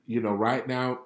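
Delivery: normal talking voice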